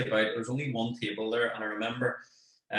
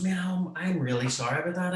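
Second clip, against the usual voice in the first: higher-pitched, flustered sorry; retold apology; 7 semitones higher than usual. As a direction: imitate an embarrassed lad apologising, voice up.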